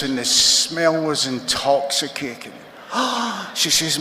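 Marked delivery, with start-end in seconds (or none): 2.9-3.5 high, acted intake of breath; awed gasp. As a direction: gasp as if catching a wonderful smell. Wife smelling steak, acted out.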